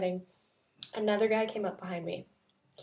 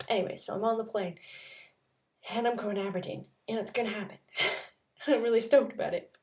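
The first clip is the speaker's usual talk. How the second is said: ordinary talk, nothing marked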